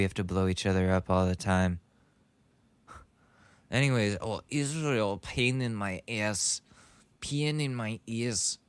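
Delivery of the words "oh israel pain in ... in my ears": slow, drawn-out mock German accent; imitated German accent. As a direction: mimic his complaint in a badly faked German accent. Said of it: "I'm trying to imitate this specific guy".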